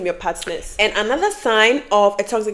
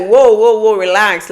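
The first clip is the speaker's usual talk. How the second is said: louder, insistent whoas; calming someone down; 8 dB louder, slower than usual. Retold or imitated. Boyfriend stopping the sister's advances, loud protest. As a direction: cut in loudly to halt it. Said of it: "trying to keep it like".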